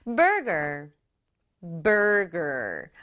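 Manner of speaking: ordinary talk, nothing marked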